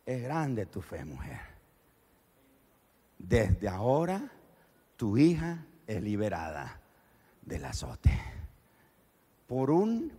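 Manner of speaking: soft, low, slow reassurance; Jesus gently granting the healing; tender blessing. Acted. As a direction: speak it gently, full of grace. Jesus stops and blesses her.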